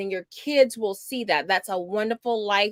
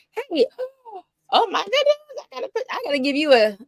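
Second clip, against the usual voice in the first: a sudden, very high delighted squeal; light up at a hometown name on the chat; excited surprise; 11 semitones higher than usual. Real, not acted. Spots a viewer from her hometown.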